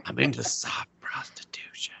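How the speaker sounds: soft whispered aside; whispered confession. Retold or imitated. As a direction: whisper it like a naughty secret. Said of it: "Oh, yeah, totally".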